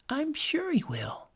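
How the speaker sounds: put-on high, soft little child's voice; a small child reassuring friends. A narrator's reading. Child to friends, high and soft. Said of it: "agreed another".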